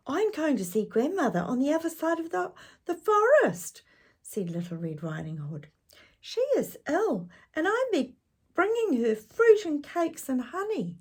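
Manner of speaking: put-on very high, innocent little girl. Girl telling the wolf her plan, high and innocent. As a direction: chirp it trustingly.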